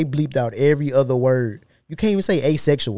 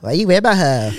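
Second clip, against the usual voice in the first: mock woman's voice; 5 semitones higher, 5 dB louder than usual. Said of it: "how you be saying".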